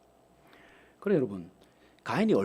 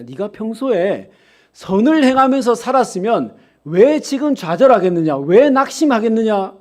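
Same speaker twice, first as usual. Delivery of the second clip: loud, higher, rising preacher's cry; preaching at full force; 5 semitones higher, 9 dB louder, slower than usual. Acted. Preacher pressing the congregation about Cain, loud and high. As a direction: drive the point home to the pews.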